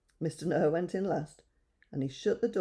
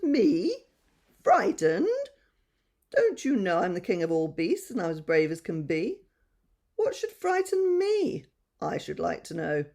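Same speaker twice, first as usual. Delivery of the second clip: haughty lion; 4 dB louder than usual. Acted. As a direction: sniff at the very idea, nose in the air. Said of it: "turning up his nose".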